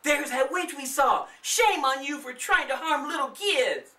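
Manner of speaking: put-on high, loud accusing girl; scolding. Darlene accusing the old woman of harming kids, loud high scolding. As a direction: scold the witch. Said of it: "Darlene scolded".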